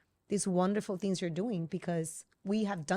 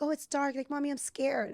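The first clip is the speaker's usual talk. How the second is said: higher, lightly imitated little kid; a touch of childlike fear, pitched up; scared child; 7 semitones higher than usual. Child tells mommy about the dark room, mildly scared.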